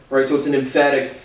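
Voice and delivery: ordinary talk, nothing marked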